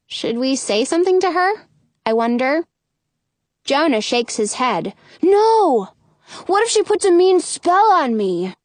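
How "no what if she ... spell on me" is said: scared shout. Acted. Jonah afraid of spell.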